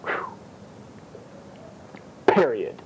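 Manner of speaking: usual speaking voice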